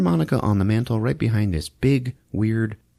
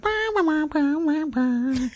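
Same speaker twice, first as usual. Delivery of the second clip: high, soft sung bahs imitating a harmonica; mock harmonica; 6 dB quieter, faster than usual. Kevin playing harmonica for the snake lady, high soft bahs. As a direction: toot it out like a mouth organ. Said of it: "He starts playing a harmonica".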